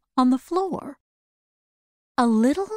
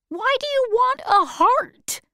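much higher, wondering question; puzzled wizard; 13 semitones higher than usual. Acted. Oz asking the Tin Woodman why he wants a heart, high puzzled.

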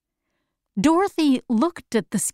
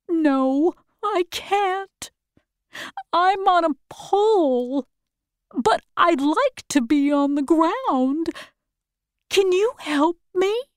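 pleading scarecrow; 7 semitones higher than usual. Acted. Scarecrow on a pole asking Dorothy for help, pleading. Scarecrow voiced in story reading.

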